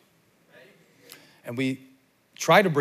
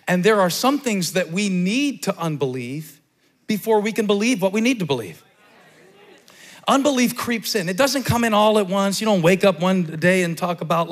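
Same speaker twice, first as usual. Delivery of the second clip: full-force preaching; 6 semitones higher, 7 dB louder than usual. Real, not acted.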